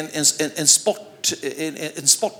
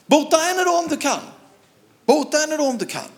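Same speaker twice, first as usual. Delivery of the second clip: mocking shout; 11 semitones higher than usual. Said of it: "Och så skrek de".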